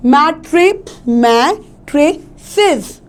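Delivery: Mattresses slowly split into मै, tray, सिस; syllable-by-syllable stress. Shown as a demonstration. Teacher showing learners where to stress mattresses, slow separated syllables. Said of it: "हमें stress कहाँ देना है".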